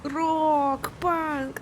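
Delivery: hyped rocker squeal imitation; anime fan. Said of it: "это прям типа".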